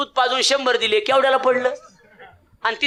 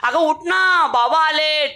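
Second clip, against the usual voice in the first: calling out; 6 semitones higher, slower than usual. Retold or imitated. Husband calling wife loudly.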